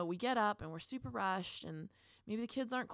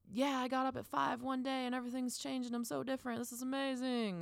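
mock excitement; 6 semitones higher than usual. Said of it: "It's not like".

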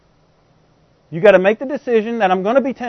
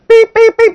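high, loud electronic beeps; chirpy mock pager alarm; pager beeping; 8 dB louder than usual. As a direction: beep out like a pager going off. Imitating God's call on a pager.

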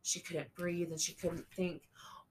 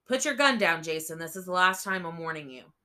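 barked command; 8 dB louder, faster than usual. A narrator's reading. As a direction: shout the final warning at him. Officer warning, chapter repeat.

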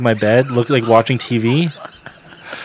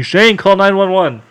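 higher, slow urgent call; urgent yell; 8 semitones higher, 4 dB louder than usual. Retold or imitated. Cousin yelling at Shane to phone for help, urgent. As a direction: shout the order down the stairs. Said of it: "he yells at me".

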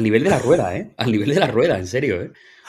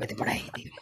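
whispered; 9 dB quieter, faster than usual. Real, not acted. Teasing aside after the quote.

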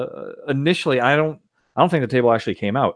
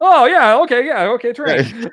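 mock delighted approval; 14 semitones higher, 4 dB louder than usual.

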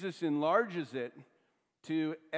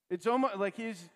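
normal talking voice

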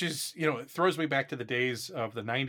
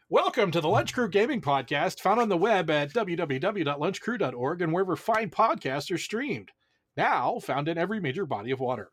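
podcast announcer; 3 semitones higher, 4 dB louder than usual. Acted.

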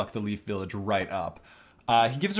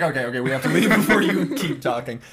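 laughing; 4 dB louder than usual. Retold or imitated. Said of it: "Kabuto's like".

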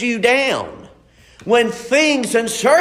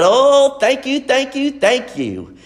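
eager, rising thank-yous; excited thanks; 4 semitones higher than usual. Retold or imitated. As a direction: gush out the thank-yous happily. Retold joyful thanks.

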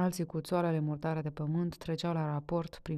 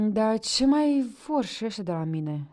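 louder, higher bitter outburst; grinding fury; 5 semitones higher, 9 dB louder than usual. A narrator's reading. Panteleu rails after the company leaves, louder and higher. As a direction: spit it out, fed up and furious. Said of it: "scrâșni Panteleu".

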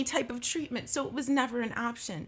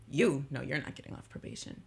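probation officer; 7 semitones lower than usual. Retold or imitated. Probation officer refusing her.

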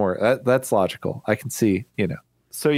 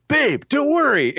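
mock heroic reassurance; 14 semitones higher than usual.

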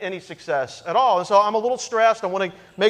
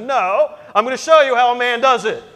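louder, faster, dead set on it; stubborn loud boast; 5 semitones higher, 9 dB louder than usual. Retold or imitated. Husband insists loudly to his wife he will do it himself, stubborn boast.

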